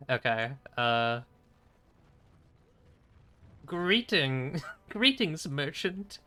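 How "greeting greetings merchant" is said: high, swooping mock wizard. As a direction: play a hammed-up wizard addressing a merchant. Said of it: "wizard voice?".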